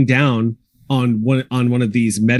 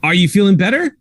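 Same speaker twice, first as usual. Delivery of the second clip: put-on a lightly higher, brighter girl's voice; little girl checking on the robot, sweet and higher; young girl, sweetly; 6 semitones higher than usual. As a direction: ask it like an eager little girl. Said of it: "you hear Charlotte's voice go".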